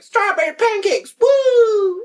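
very high, loud squeaky toy cheer; Furby voice. Retold or imitated. Two Furbies in the car echoing the sister, in a very high squeaky cheer. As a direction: squeal it like an excited talking toy. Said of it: "they fucking were like".

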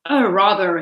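ordinary delivery

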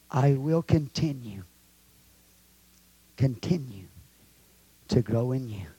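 soft, hushed prayer; softly praying after the sermon; quiet prayer. Acted. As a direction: murmur it low and devoted.

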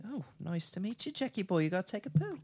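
put-on Freddy greets Jack in an English accent. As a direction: greet him with English charm. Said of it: "that English one".

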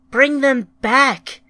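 loud, high, drawn-out shout; grieving shout. A narrator's reading. Ryan, wishing she could cry, shouts at the planet. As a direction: shout a desperate demand, slowly. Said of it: "shouted Ryan".

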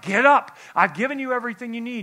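loud Get up, then fast; loud command. Retold or imitated. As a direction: call out the command, then rush on. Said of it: "And he's like".

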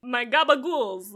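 loud mock-Italian exclamation, laughing; mock Italian accent. Co-host tops the alliteration joke, mock Italian accent.

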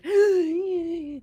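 high, drawn-out acted gasp; reviving gasp. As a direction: gasp as if coming back to life. Game master voices character coming to.

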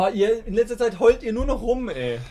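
high, loud scolding; exasperated scolding. Acted. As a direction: tell them off, fed up. Scolding the whining party.

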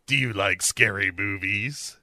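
mock menacing phone stalker; creepy caller voice. Mystery caller toying with Sarah, mock creepy voice. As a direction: tease with playful horror-film menace. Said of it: "the voice is like".

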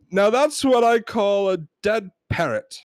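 triumphant British complainer; British accent. Acted. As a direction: declare it slowly in put-on British. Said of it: "We're gonna try our British accents".

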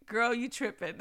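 ordinary talk

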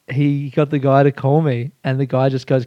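ordinary talk, nothing marked

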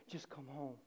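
low, very soft plea; hushed longing; acted pleading father. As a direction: plead quietly, almost to yourself. Waiting father pleading softly.

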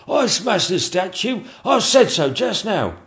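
high, loud, slow insistence; insistent courtier. Acted. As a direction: claim the reward. Chamberlain claiming money.

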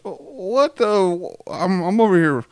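a hammed-up high whine from the brother who stayed home; jealous older son. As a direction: sulk like the son who got left out. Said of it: "He goes".

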